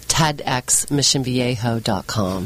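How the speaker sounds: slow, low American accent. Shown as a demonstration. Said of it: "I could do that in an American accent".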